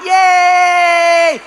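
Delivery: high, loud, drawn-out "yay"; exaggerated happy shout; gleeful fun cheer. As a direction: whoop like someone having silly fun. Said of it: "That's like a fun time".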